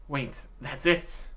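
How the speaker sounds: usual speaking voice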